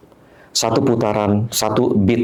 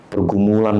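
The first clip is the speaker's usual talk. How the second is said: normal talking voice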